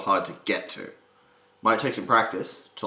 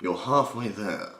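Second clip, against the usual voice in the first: British accent; slower than usual. Shown as a demonstration. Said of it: "If you can talk like this".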